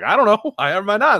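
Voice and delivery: high, playful feigned cluelessness; high mock-innocent shrug. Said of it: "And I'm like".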